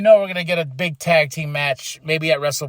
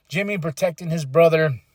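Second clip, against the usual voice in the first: ordinary talk, nothing marked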